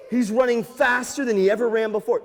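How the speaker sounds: plain reading voice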